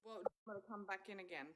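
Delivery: very faint, rushed phone audio; cutting out on a bad mic; bluetooth headset mic. Real, not acted. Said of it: "You've got very weird sound tonight".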